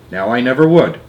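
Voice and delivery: plain reading voice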